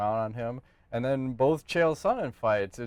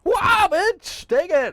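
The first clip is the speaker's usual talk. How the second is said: high, loud battle cry; a hammed-up fighter's war whoop; hyped-up yell; 8 dB louder, much slower than usual. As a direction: scream it like landing the knee. Acting out flying knee.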